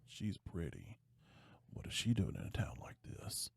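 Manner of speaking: hushed admiring whisper. Retold or imitated. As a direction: murmur it under your breath. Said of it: "he's like".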